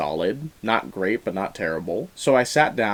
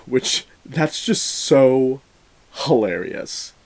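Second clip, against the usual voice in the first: amused laughing; 3 semitones higher, 3 dB louder, much slower than usual. Real, not acted. Reviewer tickled by the characters' actual names, amused laughing. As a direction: laugh breaking into slow, drawn-out words.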